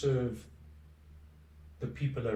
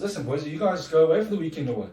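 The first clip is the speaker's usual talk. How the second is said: imitating a caller's quick, louder, higher chiding; Laura; 5 semitones higher, 8 dB louder, faster than usual. Laura phones the golf-talking boys to cut in, quick raised mock check-up. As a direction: lightly mimic someone interrupting on the phone, raised and rapid. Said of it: "Laura phones us and says to us".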